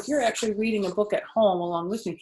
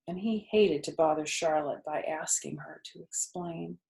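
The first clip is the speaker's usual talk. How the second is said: usual speaking voice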